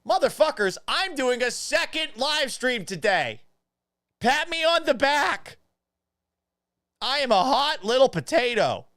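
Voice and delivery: high, loud self-celebration; over-the-top triumphant yell; hyped-up shouting. Pretend. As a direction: crow about yourself at full pitch. Streamer hypes himself up, shouting.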